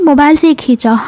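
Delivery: plain insistent statement, falling at the end; insistent denial. Retold or imitated. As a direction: state the excuse as settled fact. Cornered friend insists on her excuse. (ज़ोर देकर सफ़ाई देते हुए)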